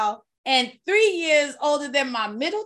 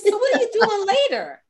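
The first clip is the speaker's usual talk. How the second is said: eager flirting; 9 semitones higher, 6 dB louder than usual. Retold or imitated.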